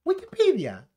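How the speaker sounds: high, swooping British pronunciation; exaggerated British accent. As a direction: mimic a very British exclamation, pitch dropping at the end. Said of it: "a Henry Cavill hablar en británico".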